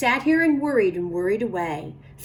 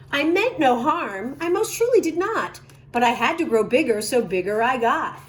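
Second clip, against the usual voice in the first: usual speaking voice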